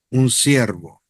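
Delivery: normal talking voice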